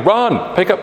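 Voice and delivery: high-pitched mock shout at the pupils; shouting PE teacher. Irish PE teacher shouting at children in cross country, high mock yell. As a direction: imitate a PE teacher calling out to runners. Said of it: "my Irish P E teacher shouting".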